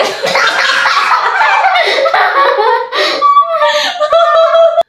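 high helpless laughter with crying edge; burst of laughter. Real, not acted. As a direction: break into uncontrollable laughter. Bursting out during the challenge.

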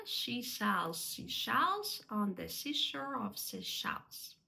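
slow, careful articulation; practice-pace tongue twister. Shown as a demonstration. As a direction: separate each s and sh slowly. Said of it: "треба спочатку повільно, правильно".

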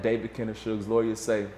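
ordinary delivery

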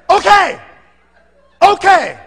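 high, loud mock concession; exasperated giving in. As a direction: burst out as the cornered father-in-law. Father-in-law finally bursts out answering.

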